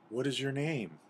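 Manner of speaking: timid little creature voice; timid animals. Acted. Frightened animals approach the giant.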